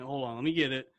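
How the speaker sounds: ordinary talk, nothing marked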